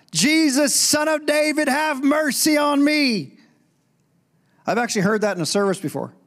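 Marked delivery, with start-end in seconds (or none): 0.1-3.4 high, pleading call for mercy; crying out. Acted. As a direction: call out high like a desperate beggar, drawing out the end. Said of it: "it says he cries out".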